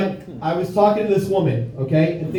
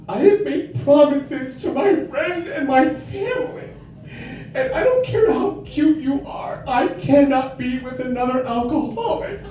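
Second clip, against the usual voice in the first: high-pitched mock-earnest woman; hammed-up heartfelt rejection in a raised voice; earnest woman lecturing; 7 semitones higher than usual.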